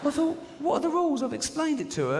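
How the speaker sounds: high-pitched comic exasperation; exasperated protest. Acted. Acted exasperation at the journalist.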